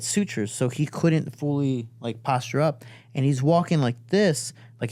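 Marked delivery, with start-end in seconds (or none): none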